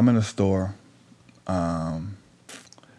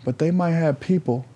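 high, insistent reasoning; retold indignant appeal; 7 semitones higher than usual. Storyteller asking the man about people at the border, urgent. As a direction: press the point in a raised pitch.

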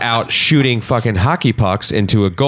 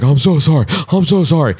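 put-on grovel like the neighbor kid, higher; mock apologetic teen; 6 semitones higher than usual. The teen who dented the van apologizing, mock sorry. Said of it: "He's like".

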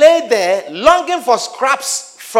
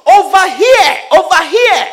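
anguished shout; 7 semitones higher, 4 dB louder than usual. Acted. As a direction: scream for help from the fire. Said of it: "The rich man shouted".

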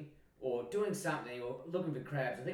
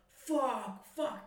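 retold shock; 8 semitones higher than usual. Just pushed off the rock face.

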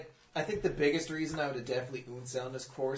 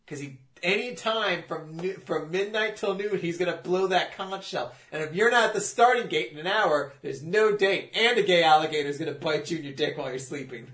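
mock ranting; 4 semitones higher, 7 dB louder than usual. Mock threat about race rules.

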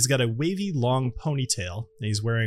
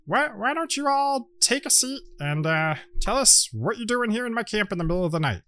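higher-pitched gnome host; gnome camp leader; 9 semitones higher than usual. Acted. Gnome asks visitors why they came, higher character voice. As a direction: invite them to sit, pitched up in character. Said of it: "He says, uh".

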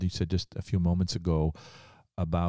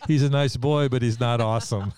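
a lightly voiced lady, higher and louder; mock her firm correction, a touch higher; imitating a lady; 4 semitones higher, 5 dB louder than usual. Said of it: "She goes".

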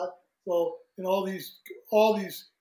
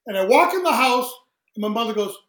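ordinary talk

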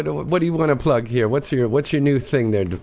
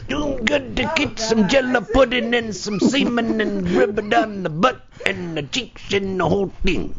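Bill Cosby voice; 7 semitones higher than usual. Retold or imitated. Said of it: "He goes".

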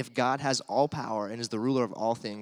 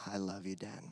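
soft thanks; 4 semitones lower, 12 dB quieter than usual. Retold or imitated. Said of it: "he was saying".

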